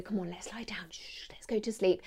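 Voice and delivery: put-on soft bedtime whisper to a child; whispering over a crib. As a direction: hush them gently to sleep. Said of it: "role play a little bit with it".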